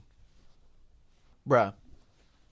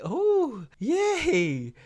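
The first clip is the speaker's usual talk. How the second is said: mock cheer; 13 semitones higher than usual. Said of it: "I was like".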